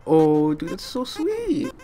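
high, very slow, squeaky falsetto; mock touched squeal. A gamer swooning at the flower, high and slow.

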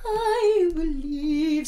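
slow airy declaration; mock angelic. Dolly's angel blessing the wife, slow and airy. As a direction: intone it like a gentle angel. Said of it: "she's like".

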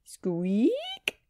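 drawn-out rising creak on the word; squeaking gate. A narrator's reading. Sam opens the gate, word voiced as a squeak. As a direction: stretch the word like a rusty hinge. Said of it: "the gate squeaked open".

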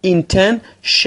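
Intention drawn out very slowly, ending in a clear shun sound; intention pronounced. Shown as a demonstration. (Intention را خیلی کشیده بگو و آخرش را شن تلفظ کن)

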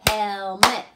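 loud "Pencil" in two beats; clapped syllables. Shown as a demonstration. Teacher clapping a household word with pupils. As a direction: clap out "Pencil" with strong beats. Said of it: "Ready? Go".

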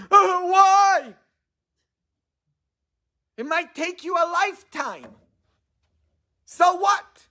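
squeaky falsetto; high-pitched whiny voice; high mock voice. Voicing people brushing it off.

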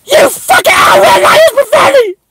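very high, loud acted shriek; tearful outburst. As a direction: shriek through mock tears at a betrayal. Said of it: "I was crying. I was crying".

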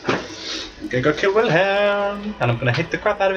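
menacing vow. Real, not acted. Vows to kill boss.

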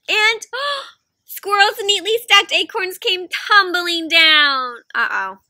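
slowed gasping disaster voice; mock-alarmed uh-oh; dramatic dismay. Acorns tumble dramatically.

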